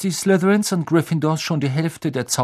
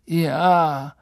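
brave sufferer; 5 semitones higher, 3 dB louder than usual. A narrator's reading. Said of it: "mit der Miene des tapferen Kämpfers".

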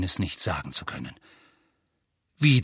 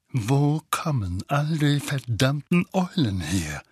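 slow angry growl; irritated uncle growling; 3 semitones higher, slower than usual. A narrator's reading. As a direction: growl it out slowly, word by word. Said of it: "knurrte er".

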